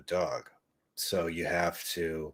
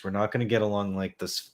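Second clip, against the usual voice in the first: ordinary delivery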